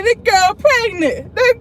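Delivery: high, loud, laughing through the words; laughing disbelief. Retold or imitated. Retelling seeing her friend's belly, laughing high and loud. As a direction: crack up while you say it. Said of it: "I said".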